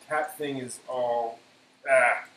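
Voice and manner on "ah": usual speaking voice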